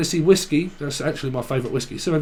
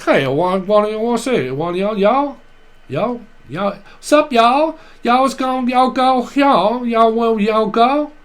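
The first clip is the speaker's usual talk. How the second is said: a hammed-up Southern American accent, much higher and louder; calling out to the crowd as a Southern American; mock Southern drawl; 7 semitones higher, 5 dB louder, slower than usual. Psychic impersonates a Southern viewer, in a high, loud mock Southern accent. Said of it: "someone that's like".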